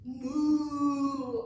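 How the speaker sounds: loud playful cow moo. Acted. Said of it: "What does a cow say?".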